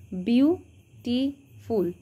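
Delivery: Beautiful slowly split into three syllables, বিউ, টি, ফুল. Shown as a demonstration. Teacher counting syllables for students, beautiful said slowly in three separate parts. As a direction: break beautiful apart with a pause after each syllable. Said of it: "তিনটে syllable আছে".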